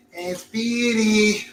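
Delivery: slow, drawn-out lazy cartoon voice; Slowpoke Rodriguez. Host imitating the sleepy buddy, slow drawn-out drawl. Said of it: "his buddy's name".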